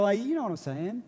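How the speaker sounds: normal talking voice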